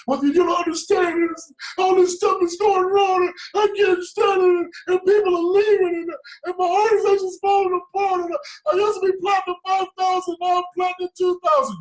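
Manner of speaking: shrill, loud mock sobbing whine; whiny crying complaint. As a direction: wail like a spoiled mentee at a pity party. Mocking a whining mentee.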